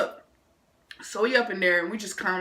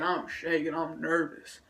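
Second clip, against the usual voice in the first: ordinary talk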